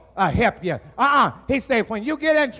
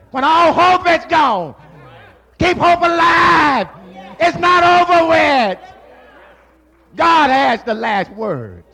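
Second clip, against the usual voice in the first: higher, louder, shouted exhortation; preaching at full force; 6 semitones higher, 7 dB louder than usual. Acted. Preacher rallies the congregation, shouted preaching. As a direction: lift them up, shout it out.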